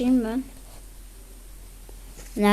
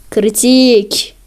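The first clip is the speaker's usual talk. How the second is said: loud; 7 dB louder than usual. Real, not acted. Loud repeat in disbelief.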